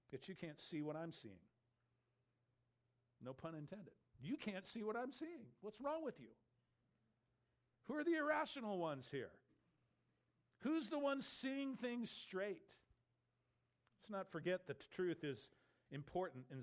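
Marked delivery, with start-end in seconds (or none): 4.2-6.3 higher, incredulous "You can't see what I'm seeing"; exasperated disbelief. Acted. Repeated as exasperated challenge. 7.9-12.7 high, emphatic rhetorical questions; indignant challenge. Acted. Healed man challenges his questioners.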